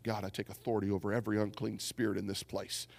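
low, hushed prayer; soft, low-pitched murmured prayer; praying under the breath. Retold or imitated. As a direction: pray it quietly to yourself. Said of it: "underneath my breath, I just began to pray".

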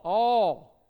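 "All" punched out very loud; emphatic All. Real, not acted. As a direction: hit "All" hard as the one answer. Answers own question emphatically.

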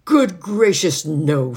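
loud, high, indignant outburst; angry refusal. A narrator's reading. Housemaid refuses the children's flowers, loud and angry. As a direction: exclaim it loudly, scandalised and cross. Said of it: "she sounded really angry".

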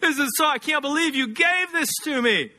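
a hammed-up gush of thanks; mock gushing thanks. An employee mock-gushes thanks to the boss for a paycheck. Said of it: "You don't break out into worship".

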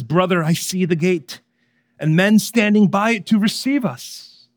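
lightly acted call of hope; encouraging. A narrator's reading. Hopeful encourages his sinking friend.

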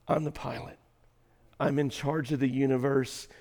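soft, hushed reassurance; God's reassuring voice. Acted. God reassuring an anxious believer, soft and tender. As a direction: speak as God, calming a worried child.

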